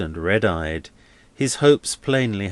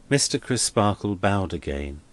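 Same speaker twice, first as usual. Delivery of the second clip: usual speaking voice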